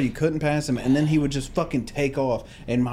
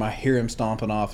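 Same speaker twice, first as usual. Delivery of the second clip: ordinary delivery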